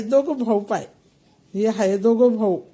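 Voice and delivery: ordinary talk, nothing marked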